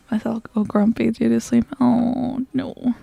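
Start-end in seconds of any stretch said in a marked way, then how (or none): none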